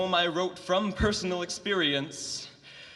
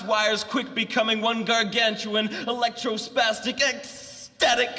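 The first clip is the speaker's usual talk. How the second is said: intense, building slam poem delivery; slam poetry; 7 semitones higher, 4 dB louder than usual. Acted. Poet up against an electrified fence, slam poetry. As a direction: declaim it with mounting electrified urgency.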